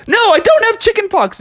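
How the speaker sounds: very high, loud protest; desperate denial. Acted. Frog pleading with hotel guests, high frantic denial. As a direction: insist loudly in a squeaky-high voice.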